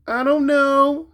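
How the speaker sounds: put-on high, drawn-out kid's whine; whiny child. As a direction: play the sulky little boy. Grandson voiced again.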